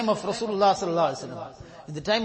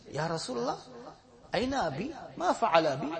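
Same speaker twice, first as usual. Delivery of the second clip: put-on hushed child's plea; 8 dB quieter, faster than usual. Orphan boy asking Prophet for father.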